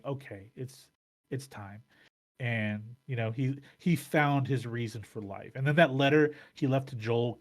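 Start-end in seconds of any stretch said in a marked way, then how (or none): 0.0-1.8 much softer, gently retold farewell; imitating a resigned goodbye, hushed; quiet acceptance. Retelling Bill's farewell to Frank.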